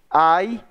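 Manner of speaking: "ai" numa sílaba só, o "a" deslizando para o "i"; vogais juntas. Shown as a demonstration. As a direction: diga "ai" emendado, como ditongo. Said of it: "Sai praticamente junto". ("ai" com as vogais juntas)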